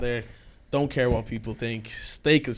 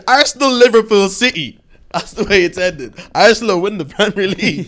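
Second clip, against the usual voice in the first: excited; 7 semitones higher, 9 dB louder than usual. Real, not acted. Confident football prediction, hyped.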